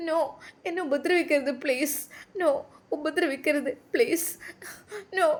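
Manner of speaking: high, tearful begging; acted whimpering in broken bursts; crying plea. A narrator's reading. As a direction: sob out a plea to be spared. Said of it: "അവൾ കരഞ്ഞുകൊണ്ടേയിരുന്നു".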